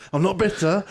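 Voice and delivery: laughing it off. Real, not acted. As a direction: laugh through the words. Laughing at sore point.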